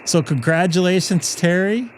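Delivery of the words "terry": ordinary delivery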